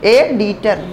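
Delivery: एडिटर begun with a clear 'ay' vowel; corrected pronunciation. Shown as a demonstration. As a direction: say एडिटर as AY-dee-ter. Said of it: "उच्चारण अगर ए से करा".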